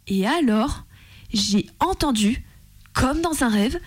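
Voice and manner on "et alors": ordinary talk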